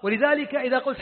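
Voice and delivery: ordinary talk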